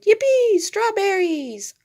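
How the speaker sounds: high, loud joyful yell, stretching strawberries slowly; shouted. A narrator's reading. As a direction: whoop it out with delight. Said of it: "Doggy Daddy shouted".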